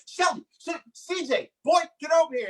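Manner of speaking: high mock yell at the kid; frustrated dad yelling. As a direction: imitate an exasperated dad calling his boy. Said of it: "he'd get upset".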